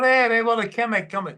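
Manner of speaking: high, loud broad Liverpool shout; Liverpool accent. Retold or imitated. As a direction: call out in thick Scouse to the crowd. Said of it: "thickest Liverpool".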